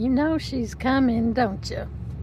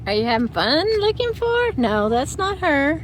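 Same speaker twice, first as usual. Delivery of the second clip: high pet voice; 7 semitones higher, 4 dB louder than usual. Real, not acted. To Finn the dog waiting for mom, loud high baby talk. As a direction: reassure the dog in a lifted voice.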